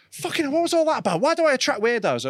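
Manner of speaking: high-pitched mock exasperation; wound-up, high imitation of a friend; exasperated imitation. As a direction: complain way up high, as the put-upon friend. Phil complaining after a heated row.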